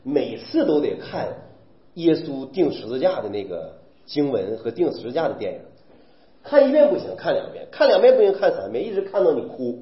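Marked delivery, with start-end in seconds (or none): none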